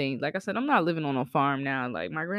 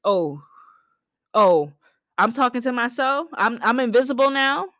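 higher, louder mock outrage; indignant; 7 semitones higher, 8 dB louder than usual. Southerner ignored after saying good morning, louder higher indignation. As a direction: play offended at being ignored.

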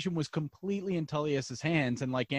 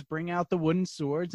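ordinary talk

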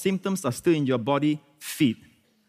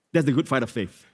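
normal talking voice

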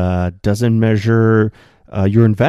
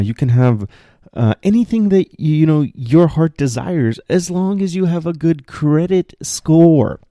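higher-pitched parroting of received advice; lightly mock someone handing down money advice; mimicked advice-giver; 6 semitones higher than usual. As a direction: recite stock advice in a raised voice. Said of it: "I've heard the advice".

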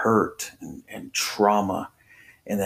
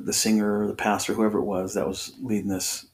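ordinary talk, nothing marked

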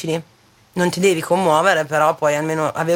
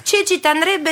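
high, bright coaxing invite; friendly invitation; 10 semitones higher than usual. Retold or imitated. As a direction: ask her out sweetly, pitched up. Friend invites Cecilia, acted quote. (invito amichevole acuto)

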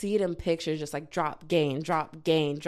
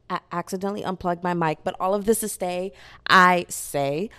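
ordinary talk

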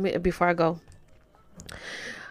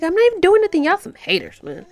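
high, loud protest; indignant retort; 10 semitones higher, 8 dB louder than usual. Retold or imitated. Answering TikTok users who report her, loud and high. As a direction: fire back at the haters, loud and high.

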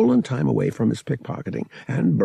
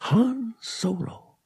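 slow, high, hushed wonder; trying it out; 13 semitones higher, much slower than usual. A narrator's reading. Boy trying his full name for size, slow wonder. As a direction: savor each word of the name. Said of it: "trying it on for size".

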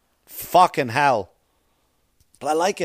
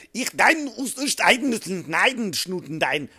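high, harsh mock German gibberish fading down; fake German gibberish; 6 semitones higher, slower than usual. Comic showing how foreigners mock languages, fake German. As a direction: bark out fake German words. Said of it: "Like German".

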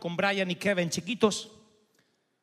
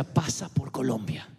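voice of the Spirit; 7 semitones lower, slower than usual. Retold or imitated. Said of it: "el Espíritu Santo me decía".

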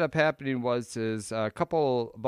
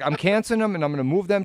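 retold flustered rush; 8 semitones higher, 6 dB louder, much faster than usual. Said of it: "she's like".

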